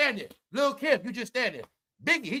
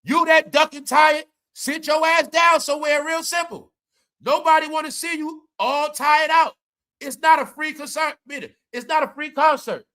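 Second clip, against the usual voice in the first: ranting; 7 semitones higher, 5 dB louder than usual. Real, not acted. Ranting at Mary.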